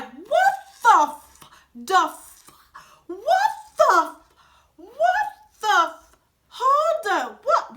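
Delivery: high, loud, stunned what the f-; shocked disbelief. Real, not acted. Telling viewers she and her grandma reacted in shock, loud and high. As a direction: blurt it out in total disbelief, voice jumping up.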